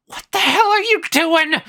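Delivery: high, loud angry yell. Retold or imitated. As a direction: yell it at them. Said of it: "she'd just yell".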